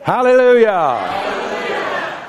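very slow, high stretched hallelujah; drawn-out hallelujah. Real, not acted. Preacher exulting to the congregation, drawn out. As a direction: stretch the praise out long.

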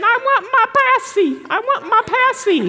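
put-on high whiny baby voice. Preacher mimics a crying teenager, high baby whine. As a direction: whimper like a toddler wanting a pacifier. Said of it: "like a little baby and crying and saying".

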